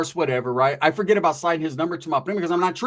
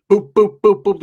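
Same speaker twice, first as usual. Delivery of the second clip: loud, higher-pitched knocking noises; imitated knocking; 4 semitones higher, 9 dB louder, slower than usual. Storyteller mimicking a knock at the door, loud boops. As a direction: punch out short, even boops.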